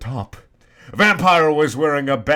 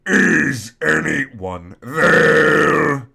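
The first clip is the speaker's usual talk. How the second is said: louder, very slow, drawn-out call; yell; 3 semitones higher, 7 dB louder, much slower than usual. Acted. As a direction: stretch it out like calling down a hallway. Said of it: "yelled Mister Norris".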